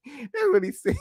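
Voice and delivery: high voice through laughter; laughing. Real, not acted. Laughing over what her husband said, high and giggly. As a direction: squeeze it out while laughing.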